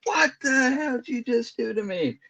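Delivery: high startled yelp at his wife; shocked yelp. Retold or imitated. Husband to wife who touched the sore spot, startled high yelp. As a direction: shriek it out in sudden pain and alarm.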